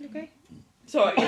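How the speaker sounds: coughing, tea down the wrong way; choking on tea. Real, not acted. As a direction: cough it out, then a quick sorry. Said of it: "My tea went down the wrong way".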